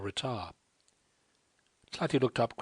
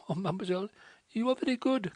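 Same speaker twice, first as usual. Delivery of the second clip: put-on higher, pleading cry of a distressed young French woman; tearful French girl crying out; 10 semitones higher, faster than usual. A narrator's reading. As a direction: plead through tears, voice lifted high. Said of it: "cried the other".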